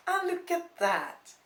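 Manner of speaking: high, drawn-out grandmotherly delight; cheerful triumph. A narrator's reading. Grandmother seeing the boyfriend arrive for Christmas, cheerfully triumphant. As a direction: crow happily at being proved right. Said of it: "cheerful grandmother looked triumphantly".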